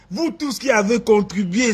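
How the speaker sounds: ordinary delivery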